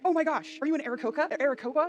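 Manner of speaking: put-on high, loud starstruck kid; excited fangirl squeal. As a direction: gush over meeting a bird person. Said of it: "she's like".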